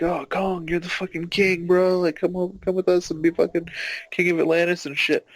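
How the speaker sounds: high, coaxing bro voice; mock flattery. Reptile people luring Kong, high coaxing bro voice. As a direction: talk him up like a hyped-up buddy. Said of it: "they're all like".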